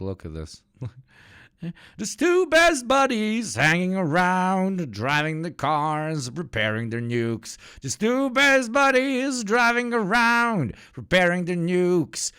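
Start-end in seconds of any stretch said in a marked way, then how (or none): 2.0-12.3 high, slow sing-song teasing; high mock cutesy voice; mock sweet narration. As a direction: coo it like describing cute pets. Mocking narration of Putin and Kim.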